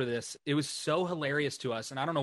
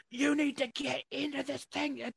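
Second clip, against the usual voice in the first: much higher, louder urgent shout; panicked crowd telling friends to take cover, urgent shout; urgent warning; 11 semitones higher, 6 dB louder than usual. Retold or imitated. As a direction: mimic people hurrying you to shelter. Crowd urging them into shelter.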